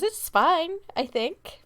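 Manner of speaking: a hint of the worried mother forcing calm; unsure mom. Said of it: "she goes".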